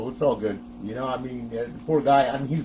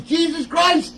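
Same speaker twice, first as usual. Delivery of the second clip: high, loud, drawn-out exclamation; imitating exasperated father; 5 dB louder, much slower than usual. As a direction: stretch it out like a fed-up dad. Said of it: "he used to say it all the time".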